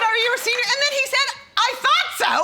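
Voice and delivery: high mock cashier.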